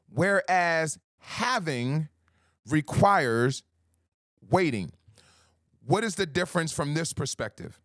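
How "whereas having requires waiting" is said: slow, deliberate "whereas having", firm "requires waiting"; slow emphasis. Shown as a demonstration. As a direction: drag out "whereas having" as a contrast. Contrast line, slowed.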